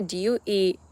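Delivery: ordinary talk, nothing marked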